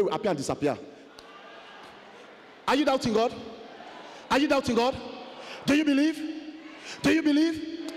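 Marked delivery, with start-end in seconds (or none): 2.7-7.9 preacher's challenge. Acted.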